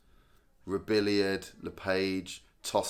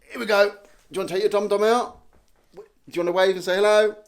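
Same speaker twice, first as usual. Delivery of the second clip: dad coaxing his daughter to wave; 8 semitones higher, 7 dB louder, faster than usual. Real, not acted. Father coaxing his toddler daughter.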